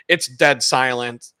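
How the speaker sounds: normal talking voice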